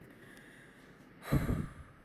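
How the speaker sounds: long unvoiced emotional sigh; heavy sigh. Real, not acted. Vlogger moved near tears by Arthur's breakdown, sighing. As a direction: let out a shaky breath. About to cry watching.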